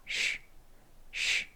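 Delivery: 쉬 as pure unvoiced English sh; voiceless sh. Shown as a demonstration. Teacher models the sh sound, 쉬 airy and voiceless. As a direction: push air through the middle for 쉬, no voice. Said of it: "가운데로 공기가 나갑니다".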